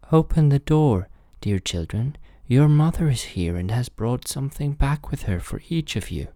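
wolf's high, sweetened fake mother; softened wolf voice. A narrator's reading. Wolf poses as mother after eating chalk, softened high voice. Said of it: "made his voice soft with it".

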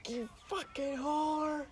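very high, slow wailing insults; crying scream. Retold or imitated. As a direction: screech the insults like a drunk in tears. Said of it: "I'm like".

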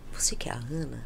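soft whisper; hushed question from the medium. Retold or imitated. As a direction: whisper it close. Medium quietly asks.

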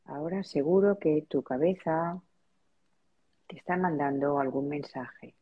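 spacious, measured relaxation-practice voice; guided meditation. Real, not acted. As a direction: lead them gently into noticing their breath, unhurried. Leads a breathing meditation.

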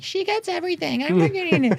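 very high, squeaky little-sibling voice; whiny younger child.

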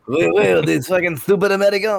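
high-pitched, hammed-up French accent; mock French accent. French seller scorning American tourists, mock French accent. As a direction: play a scornful French local, exaggerated accent.

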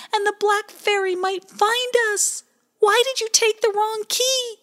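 very high, loud, upset little voice; a scared child scolding his friend; high loud fretful voice; Pie Jam's high voice. A narrator's reading. Pie Jam character voice.

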